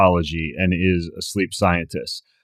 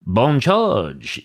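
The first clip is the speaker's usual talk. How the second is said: slow, playful mock French on Bon Charge; funny French accent; 6 semitones higher than usual. Podcast host introducing the sponsor name, in a mock French accent. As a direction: give Bon Charge a hammed-up French flair. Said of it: "say it with that funny French accent".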